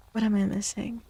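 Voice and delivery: ordinary delivery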